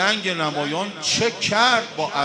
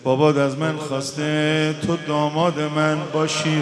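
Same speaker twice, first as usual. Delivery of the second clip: gentle uncle; 4 semitones lower, 5 dB quieter than usual. Acted. Imam Hussein gently tells Qasim his father's wish, soft and low. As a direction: break the news tenderly and hushed.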